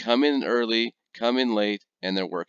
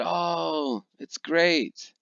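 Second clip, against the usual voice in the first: higher, forced polite praise; polite fib; 8 semitones higher, much slower than usual. Retold or imitated. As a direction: fake it's great brightly. Polite lie about spicy soup.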